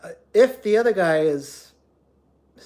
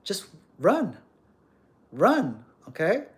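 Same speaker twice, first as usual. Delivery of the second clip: ordinary delivery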